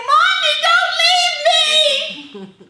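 loud, high, slow wailing plea; crying child. Retold or imitated. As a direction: wail it like a sick child left alone. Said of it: "I would cry and say".

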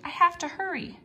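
high, urgent cry; flustered hurry. A narrator's reading. Geronimo panicking about the armored car, high urgent voice. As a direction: sound rushed and flustered.

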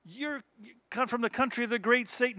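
high-pitched retold accusation; insulting accuser. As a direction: imitate an angry man hurling insults, pitched high. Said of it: "He started insulting America".

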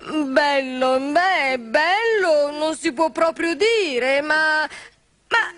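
hesitant. Acted. Character doubts the dancer was so handsome, hesitant and drawn out.

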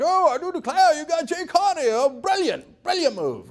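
mock British accent, cocktail-party praise. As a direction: ham up a British-accented insider gushing. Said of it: "I don't know why they're British".

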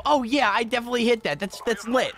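imagined excited reaction. Pretend. Gamer imagining a nice clip, mock-excited reaction.

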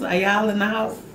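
ordinary delivery

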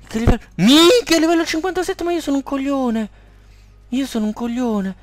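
high loud dismay fading to self-mockery; squeaky horrified disbelief; shocked squeal. Real, not acted. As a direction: freak out at the level then scold yourself. Shocked at enemy level.

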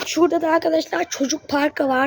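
ordinary delivery

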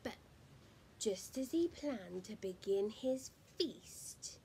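ordinary delivery

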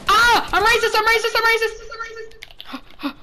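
high, rapid-fire repeated yelling; frantic shouting. Real, not acted. Gamer in a sudden panic, frantic high shouting. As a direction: blurt it over and over in a high yell. Frantic outburst during game.

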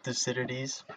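Thucydides as "thuh-SID-ih-dees"; Thucydides with no "you" sound, stress on second syllable; corrected pronunciation. Shown as a demonstration. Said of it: "I believe it's".

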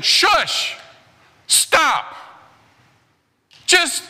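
high mock nagging voice, slow on close your mouth; squeaky hammed-up shushing nag; mocking shushers. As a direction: poke fun at the shushing friends. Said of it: "these guys are saying".

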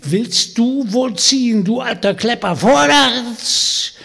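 high, loud scolding hag yelling at her nag; shrill witch shrieking. Acted. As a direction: shriek at the lazy horse. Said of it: "mit schrillem Geschrei an". (schrilles Hexengeschrei)

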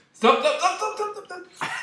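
high frantic stopping; panicked stop. Real, not acted. Beer overflowing.

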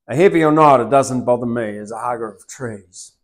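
loud, declaiming poem reading; poem recitation. Acted. Poet reciting his poem to the host, declaimed. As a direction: proclaim the verses with fervour.

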